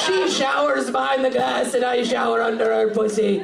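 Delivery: proclaiming mock Scottish accent. Comic voices a boyfriend bragging about his girlfriend, Scottish accent. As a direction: declare it like a boastful Scotsman. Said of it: "giving him a Scottish accent".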